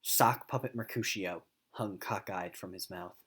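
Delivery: ordinary talk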